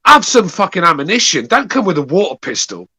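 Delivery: fired up and indignant; angry ranting. Real, not acted. Angry rant at caller.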